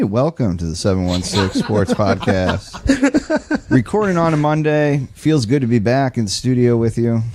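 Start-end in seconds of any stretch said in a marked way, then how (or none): 0.0-3.7 slow, drawn-out announcer welcome. Acted. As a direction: announce it like a fighting game host. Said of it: "Doing my Jeff announcer voice right now".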